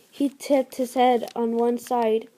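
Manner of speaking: plain reading voice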